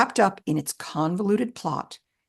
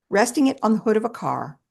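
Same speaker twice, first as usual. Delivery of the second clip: ordinary delivery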